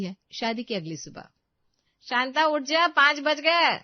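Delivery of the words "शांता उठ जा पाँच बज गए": much louder, higher barked wake-up; loud wake-up call. Acted. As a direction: yell her out of bed. Mother-in-law wakes her at dawn. (सास का ज़ोर से जगाना)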